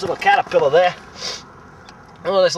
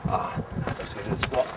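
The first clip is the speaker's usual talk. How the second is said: whisper; 6 semitones lower, 11 dB quieter, slower than usual. Real, not acted. Whispered aside while searching.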